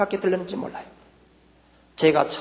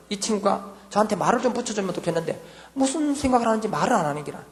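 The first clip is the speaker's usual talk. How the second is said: usual speaking voice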